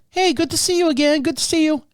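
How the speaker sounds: chirpy doctor. Retold or imitated. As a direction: greet like she knows him. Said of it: "she's like".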